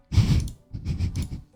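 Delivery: real hushed laugh; breathy whispered laugh. Real, not acted. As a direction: laugh under your breath, without voice. Laughs at own oversight.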